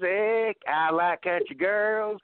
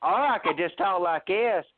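ordinary talk

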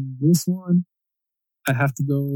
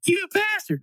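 very high, softer background squeal; mock cartoon catchphrase; 8 dB quieter than usual. Someone chiming in from the background, high thin imitation. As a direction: squeak it like a distant cartoon kid. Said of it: "you hear something in the background".

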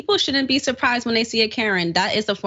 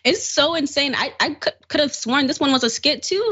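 ordinary talk, nothing marked

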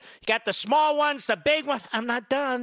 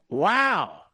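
normal talking voice

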